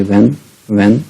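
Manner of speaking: when said as "wen", clear w. Shown as a demonstration. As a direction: model "when" slowly and clearly. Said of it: "इसका भी when पड़ेगा".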